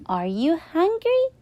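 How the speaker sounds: put-on high, gentle child question; a small boy checking on a friend; curious little boy. Flynn asking.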